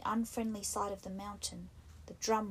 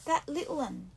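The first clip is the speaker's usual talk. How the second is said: high, breathless alarm; gasping, alarmed; 6 semitones higher, 5 dB louder than usual. A narrator's reading. Piggy gasps as he misses the boy. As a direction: gasp it out in sudden fear. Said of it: "gasped Piggy".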